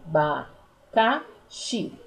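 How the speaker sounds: "Abacaxi" slowly as A, BA, CA, XI; four separate beats in "Abacaxi"; syllable by syllable. Shown as a demonstration. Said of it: "tem quatro sílabas".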